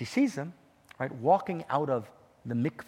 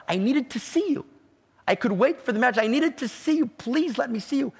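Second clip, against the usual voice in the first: higher, louder, urgent longing; pleading passion; 10 semitones higher, 6 dB louder than usual. Acted. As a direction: pour out the yearning, climbing higher. Groom recalls pleading with heaven.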